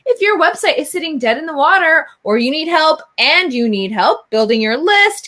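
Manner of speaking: ordinary delivery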